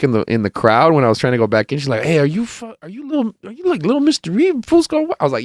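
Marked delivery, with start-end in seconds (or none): 2.0-5.2 very high, eager fan voice; imitated female fan. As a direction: mimic a woman recognizing someone, pitched way up. Imitating a woman fan asking.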